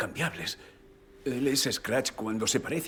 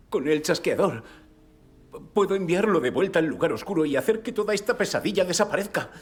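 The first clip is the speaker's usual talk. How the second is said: urgent, raised voice, rushing higher and louder; desperate insistence; 6 semitones higher, 6 dB louder than usual. Acted. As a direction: push the plan out fast and fervently. Plan desesperado contra la pesadilla. (insistencia desesperada, voz alta y acelerada)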